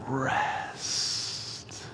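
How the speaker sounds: long breathy exhaled whisper of Rest; whispered, drawn out. Shown as a demonstration. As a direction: let Rest linger on the outbreath, whispered. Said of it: "say it slowly and quietly".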